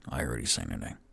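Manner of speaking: deep Onyx voice. Acted. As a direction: drop the voice low and answer dryly. Onyx answers curtly.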